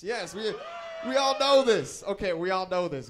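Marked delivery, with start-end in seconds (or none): 0.0-2.0 big high shout; rally the room, much higher and louder; shouting to the crowd. Real, not acted. Hyping the crowd about Payless.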